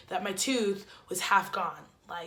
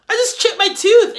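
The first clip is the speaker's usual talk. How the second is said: much higher, louder indignant cry; indignant outburst; 12 semitones higher, 12 dB louder than usual. Retold or imitated. Answering Jose about soccer, indignant high outburst. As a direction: exclaim it as if it's obvious.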